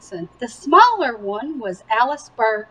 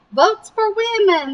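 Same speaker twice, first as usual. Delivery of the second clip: higher, cheerful call; friendly slogan; 8 semitones higher than usual. A narrator's reading. Men at gas stop calling out cheerfully. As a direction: wave them off with the slogan. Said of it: "the men said".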